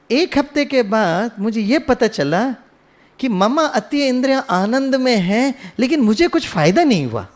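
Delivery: usual speaking voice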